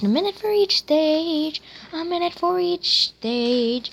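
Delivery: high, drawn-out sing-song grumble; sing-song complaint. Real, not acted. Gamer fed up with slow progress, sing-song complaint.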